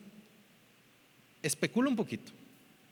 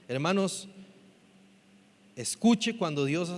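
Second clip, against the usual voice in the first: normal talking voice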